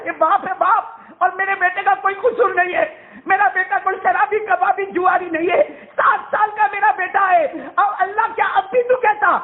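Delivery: a father begging for his son; anguished plea. Acted. Abraham pleading with God for his son, high and anguished.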